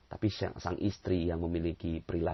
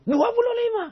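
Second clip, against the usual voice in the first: high, loud, squeaky dua puluh lima; stubborn man squeaking his wrong answer; stubborn insistence; 10 dB louder than usual. Acted.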